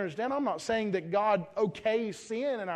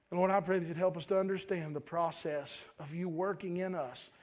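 hushed, lower public prayer; 5 semitones lower, 4 dB quieter than usual. Real, not acted. Preacher closing the sermon in prayer to the Lord, subdued and low. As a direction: pray softly and humbly, low in the voice.

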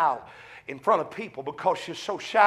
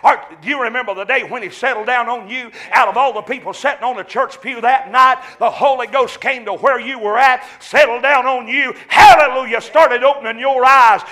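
loud, rising preacher's cry; fervent revival preaching; preaching at full force; 5 semitones higher, 8 dB louder than usual. Acted. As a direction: preach it out to the pews, building to a shout. Preaching over the congregation.

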